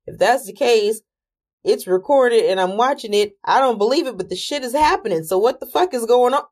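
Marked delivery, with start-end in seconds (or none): none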